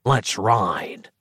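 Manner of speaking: cold hissing layered whisper; menacing rasp of a burnt doppelganger. Acted. Dark fraction speaks.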